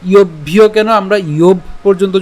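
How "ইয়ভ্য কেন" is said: ordinary talk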